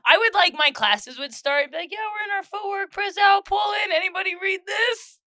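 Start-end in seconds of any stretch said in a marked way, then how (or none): 1.9-5.2 high, raised instructor call; calling out to class. Retold or imitated. Teacher asking her class about the book, calling out high. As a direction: shout out high, fishing for any takers.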